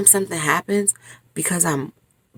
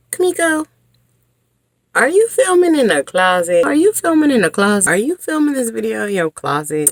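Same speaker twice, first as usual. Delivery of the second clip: imitating viewers; 6 semitones higher, 4 dB louder than usual. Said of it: "Before y'all even say".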